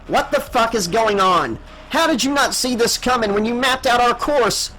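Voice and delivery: angry demand. A narrator's reading. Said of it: "Danella demanded".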